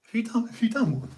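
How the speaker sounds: high, questioning mate; mock suspicious. Quizzing a mate about a fart, mock suspicious.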